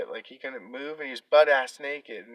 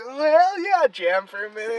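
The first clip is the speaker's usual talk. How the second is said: excited; 8 semitones higher, 7 dB louder than usual. Retold or imitated. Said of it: "I was like".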